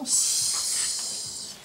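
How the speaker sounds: long voiceless hiss on "S"; pure S sound. Shown as a demonstration. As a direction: say "S" as the sound, a sustained sss. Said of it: "donc c'est le son".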